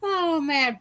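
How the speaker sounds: ordinary talk, nothing marked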